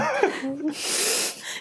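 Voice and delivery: a long high laugh; real laughing squeal; high squeal of laughter. Real, not acted. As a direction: let the laugh ring out. Laughs after catching the kid.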